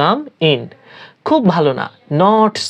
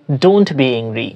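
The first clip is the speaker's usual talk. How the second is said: each word drawn out slowly; slow, clear model phrase for learners; teacher pronouncing slowly; slow demo; 3 semitones lower, much slower than usual. Shown as a demonstration. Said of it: "রাগ করো না".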